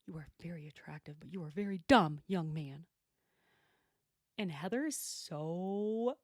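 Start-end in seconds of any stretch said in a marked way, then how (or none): 0.1-2.9 whisper. Real, not acted. Aside mock-flirting at ranch hand.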